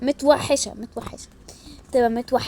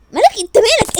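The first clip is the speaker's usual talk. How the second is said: angry snap; 8 semitones higher, 8 dB louder than usual. Acted. Siblings bickering.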